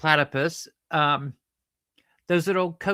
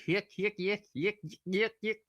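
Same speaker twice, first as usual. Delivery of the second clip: high, soft, repeated mock chuckle; comic stooge chuckle; 6 semitones higher, 5 dB quieter than usual. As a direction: chirp it rhythmically as a silly laugh. Comic stooge-style chuckle while clowning.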